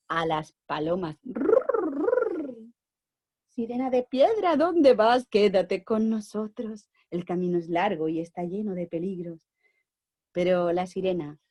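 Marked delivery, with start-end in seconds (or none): 1.2-2.6 pigeon coo. Acted. Pigeons cooing. 3.5-9.4 lightly voiced pigeons. Pigeons answer the mermaid.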